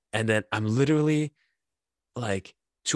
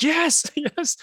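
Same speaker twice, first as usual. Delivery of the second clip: much higher, laughing cheer of relief; crowd cheering. Retold or imitated. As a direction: cheer it like the whole stand. Stadium celebrating offside call.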